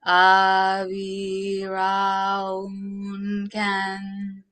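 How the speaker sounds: drawn-out chanted syllables; slow intoned mantra. Shown as a demonstration. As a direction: intone each syllable slowly. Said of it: "I like doing Obi-Ron Ken".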